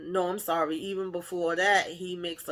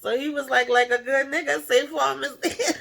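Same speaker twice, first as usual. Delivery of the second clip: cracking up mid-sentence, high and loud; laughing; 7 semitones higher, 5 dB louder than usual. Real, not acted. Retelling the Safe Farm joke, laughing so hard the words break. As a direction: laugh through the punchline. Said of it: "that had me cracking up".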